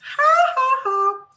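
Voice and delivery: shrill hammed-up ha ha ha; high mock laugh. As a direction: fake laugh, high and loud. Mock laugh after quote.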